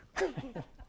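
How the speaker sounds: soft, high chuckle; real laugh. Real, not acted. Speaker amused by his own made-up word, real soft laugh. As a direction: chuckle lightly at your own slip.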